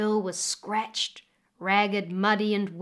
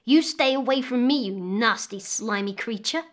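put-on loud, disgusted girl; angry; 3 dB louder than usual. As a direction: push the creature away with your voice. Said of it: "hissed the girl".

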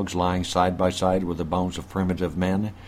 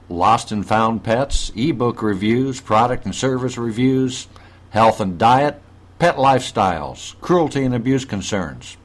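bright announcer read, a little louder; radio host intro; 3 semitones higher, 5 dB louder than usual. Acted. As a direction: open the show like a radio host. Show host opening announcement.

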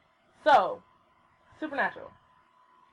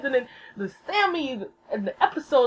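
ordinary talk

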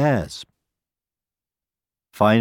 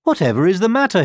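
high, quick call; 8 semitones higher, 3 dB louder, faster than usual. A narrator's reading. Another hare to the fleeing hare, calling out. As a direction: call out a puzzled question, pitched up. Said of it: "he called".

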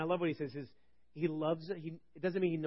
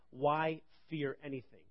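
slower, each word weighed; slow emphatic question; slower than usual. Shown as a demonstration. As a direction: let why fear anything land. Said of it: "Wow, what a statement".

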